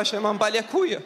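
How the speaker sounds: mock German accent; throwaway line in a put-on German accent. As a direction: slip back into the comic German accent. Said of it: "on va faire tous les spectacles comme ça".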